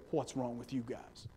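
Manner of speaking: soft mock rebuke. Jesus rebukes disciples, paraphrased.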